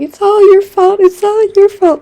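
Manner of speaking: put-on high, loud childish wail of blame; kid's screaming. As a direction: scream it like a furious little kid. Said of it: "he starts screaming".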